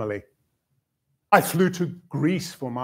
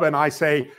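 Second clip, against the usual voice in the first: usual speaking voice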